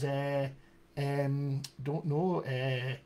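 soft, hesitant Edinburgh Scottish; Chris, Scottish accent. Retold or imitated. Coach imitates his client in Edinburgh, Scottish hesitation. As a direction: trail long Scottish thinking sounds round the words. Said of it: "if I was a Scottish accent".

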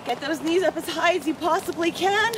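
normal talking voice

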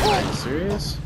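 exasperated disbelief. Real, not acted. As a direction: groan it out at the screen. Reacting to game moment.

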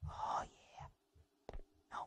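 whispering while sneaking. Real, not acted. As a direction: breathe it under your breath. Sneaking past enemy, whispering.

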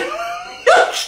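squealing burst of laughter; high laughing fit. Real, not acted. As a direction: break into helpless laughter. Lachanfall am Telefon. (hoher Lachanfall)

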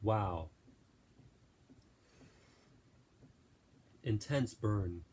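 talking through a burning mouth; strained voice, mouth on fire from hot sauce; mouth burning from spicy food. Real, not acted. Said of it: "it's burning my mouth to a crisp right now".

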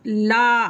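ला with a wide, held "aa"; open-mouthed आ. Shown as a demonstration. Teacher showing the आ matra in ला, wide open vowel. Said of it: "पूरा mouth open करके अगर बोला जा रहा है".

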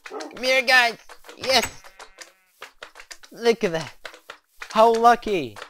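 high sing-song voice for pets; pet talk. Real, not acted. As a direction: coo at the little pets. Calls new pets sweetly.

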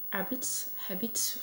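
testing "Habits" under her breath; chuchoté, hésitant; unsure whisper. Shown as a demonstration. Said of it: "mon accent anglais nul".

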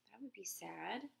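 put-on whispered, sad little girl; girl sadly whispering about the dog. A narrator's reading. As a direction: breathe it sadly. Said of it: "the girl said".

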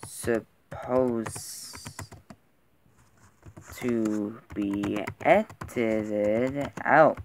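very slow, word by word; drawn out. Real, not acted. Man too tired to edit his video, slow and drawn out. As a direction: drag every word out with long pauses.

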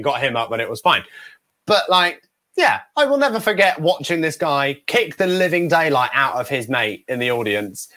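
ordinary delivery

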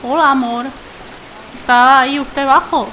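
usual speaking voice

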